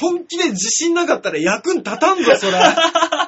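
loud and laughing; loud laughing retort. Real, not acted.

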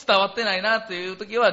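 ordinary delivery

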